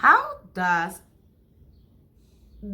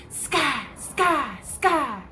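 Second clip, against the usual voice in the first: higher, sharp "ska" bursts; three sudden zaps, pitched up; lightning noise; 4 semitones higher, slower than usual. Shown as a demonstration. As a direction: imitate cracking lightning. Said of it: "make a noise like that".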